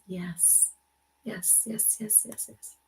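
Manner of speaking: soft, murmured string of yes; murmured yeses. Real, not acted. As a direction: agree under your breath, barely voiced. Murmured agreement to comments.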